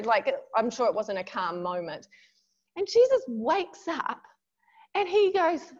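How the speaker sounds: ordinary talk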